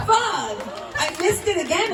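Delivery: high-pitched mock frustration; exasperated high whine in jest; mock dismay. Retelling own reaction to missed wedding.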